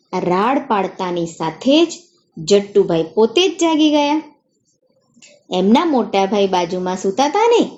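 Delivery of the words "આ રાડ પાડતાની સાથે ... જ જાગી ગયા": plain reading voice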